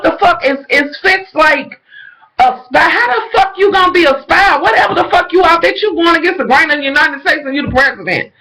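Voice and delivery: high, fast, incredulous; outraged rant. Real, not acted. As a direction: rant at him in disbelief.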